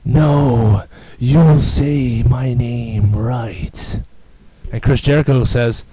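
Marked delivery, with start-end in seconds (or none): 0.0-4.0 slow, drawn-out, haughty insistence; Fandango's voice. Retold or imitated. Scene: Fandango confronting Jericho mid-interview, slow haughty demand. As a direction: stretch each word like a pompous dancer. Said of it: "I'm talking like that because that's how he talks".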